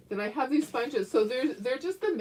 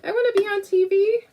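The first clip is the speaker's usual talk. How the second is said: higher, louder eager dog voice; voicing the dogs; 11 semitones higher, 9 dB louder than usual. Owner voices excited dogs wanting to be on camera. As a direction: make the dogs sound desperate for the camera. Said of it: "They're like".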